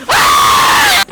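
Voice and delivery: long, high, loud shriek; terrified scream. Real, not acted. Gamer suddenly caught by enemy, screaming. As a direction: scream at full voice.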